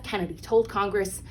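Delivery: ordinary delivery, nothing marked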